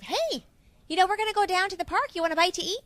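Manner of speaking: high, loud, fast Cincinnati mother-in-law; mother-in-law offering food, in a broad Cincinnati accent. Retold or imitated. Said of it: "a very sort of spread".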